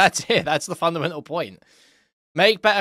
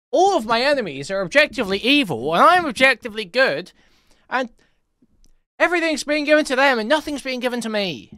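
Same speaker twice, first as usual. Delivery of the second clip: higher, exaggerated mock complaint; mocking imitation; 6 semitones higher, 4 dB louder than usual. Streamer parodying the creator's view of his enemies, mocking. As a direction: parody his grievance with big pitch swings.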